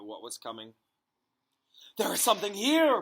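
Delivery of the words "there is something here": plain reading voice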